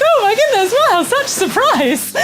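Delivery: usual speaking voice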